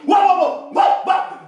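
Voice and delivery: loud, rapid mock dog barks; Nigerian dog barking. Preacher mimicking hungry Nigerian dogs still barking, loud mock barks. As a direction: bark on and on, loudly, like a tireless dog. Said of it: "they are still barking".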